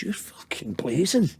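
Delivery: hushed unvoiced whisper; whispering to the big man at the bar. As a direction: lean in and breathe it. Bar guy leaning in, confidential.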